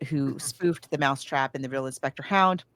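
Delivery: usual speaking voice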